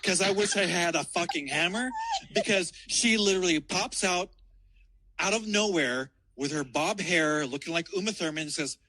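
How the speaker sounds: ordinary delivery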